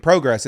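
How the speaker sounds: "Progress" with a long O in the first syllable; PROH-gress pronunciation. Shown as a demonstration. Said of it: "it's the proper pronunciation".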